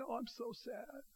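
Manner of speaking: high, very soft mock-sad voice; mock tearful sadness. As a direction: play a small, meek "so sad". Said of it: "She's not just coming with tears".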